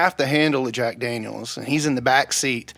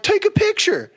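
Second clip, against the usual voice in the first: high excited demand. Retold or imitated. Friend excitedly demanding a photo.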